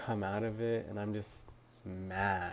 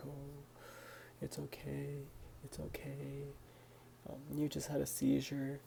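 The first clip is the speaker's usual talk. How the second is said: higher, soft comforting voice of a mother; soothing mom; 5 semitones higher, 7 dB quieter than usual. Retold or imitated. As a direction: hush her gently like a worried mom. Mom soothing her after seizure.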